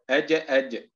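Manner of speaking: أج، أج with a strong echo on the j; أج، أج with a full qalqala release; stronger qalqala. Shown as a demonstration. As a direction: bounce the final ج of أج hard, twice. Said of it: "التمييز بين مراتب القلقلة".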